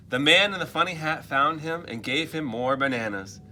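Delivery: usual speaking voice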